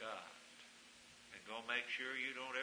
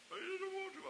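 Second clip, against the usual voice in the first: mock whine; faster than usual. Voicing the young man again.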